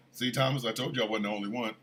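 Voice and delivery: low, soft, quick imitation of a wife; hushed vindication; wife's smug aside. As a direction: murmur a quiet I-told-you-so. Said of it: "sitting back going".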